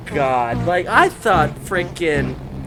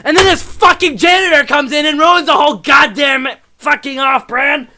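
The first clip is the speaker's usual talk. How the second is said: high, loud, furious outburst at the janitor; furious rant; 10 semitones higher, 8 dB louder than usual. Real, not acted. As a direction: explode in real outrage, voice pitched way up. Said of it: "Sorry, I'm a bit emotional about this".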